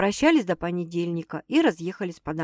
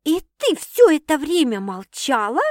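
higher, outraged yell; 7 semitones higher, 4 dB louder than usual. A narrator's reading. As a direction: explode at the sister. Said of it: "заорала сестра". (возмущённый крик)

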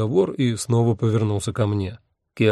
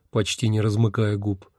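ordinary delivery, nothing marked

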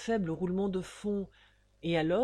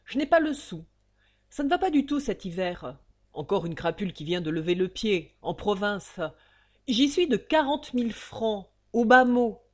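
grumbling miser's lament; whining complaint; 6 dB louder than usual. Acted. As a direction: moan about losses. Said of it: "ces jérémiades".

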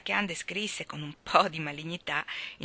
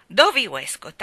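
stern call; 9 dB louder than usual. Acted. Ann scolds crying Dovi.

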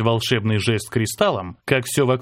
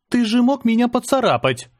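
high, peeved complaint; a touch of annoyed reproach; mild irritation; 11 semitones higher than usual. A narrator's reading. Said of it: "С лёгким раздражением заметила Рада".

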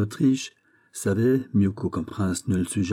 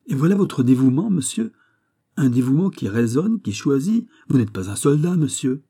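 higher, louder royal reproach; indignant rebuke; 5 semitones higher, 5 dB louder than usual. A narrator's reading. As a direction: scold a subordinate with wounded authority. King rebukes musketeer's devotion.

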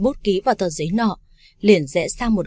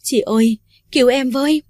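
mildly tearful, higher-pitched plea; raised, lightly acted cry for help; 5 semitones higher, 4 dB louder than usual. A narrator's reading. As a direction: plead for help with a touch of crying. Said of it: "bật khóc lớn".

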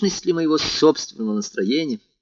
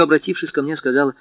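plain reading voice

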